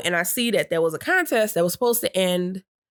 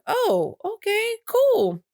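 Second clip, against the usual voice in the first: higher, breezy and casual; high casual reply; much slower than usual. Retold or imitated. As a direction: toss it off lightly, higher. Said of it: "So I'm like".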